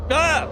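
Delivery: sharp high shriek, real fright; startled scream. Real, not acted. Player jumping at a scare, screaming. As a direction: yelp as the scare hits.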